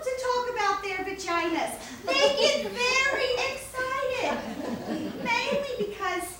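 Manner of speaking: high, loud, gushing delivery; building excitement. Acted. Performer telling an audience women love to talk, excitedly. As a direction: let it burst out, stretched and high.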